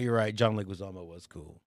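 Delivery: ordinary talk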